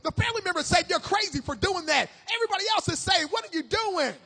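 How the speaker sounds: impassioned preacher in full flow; fervent preaching. Real, not acted. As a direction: build the sermon in loud rhythmic waves.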